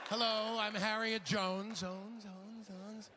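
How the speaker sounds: slow, high intro that echoes away; echoing speaker. Pretend. Convention speaker introducing herself with fading echo. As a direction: let your name bounce off the arena walls.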